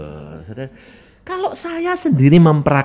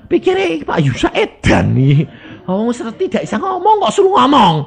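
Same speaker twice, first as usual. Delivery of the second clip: ordinary talk, nothing marked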